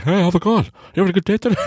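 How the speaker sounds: high-pitched, chirpy over-friendly hello; over-eager greeting. Retold or imitated. Greeting someone on the bus, high, over-eager. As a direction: pipe up eagerly at a stranger.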